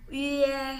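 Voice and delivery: high, loud "ie" starting on rounded ü; held "ie" like a near retch, üe; as if about to retch. Shown as a demonstration. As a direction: glide "ie" from pouted ü to eh. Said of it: "kayak agak mau muntah".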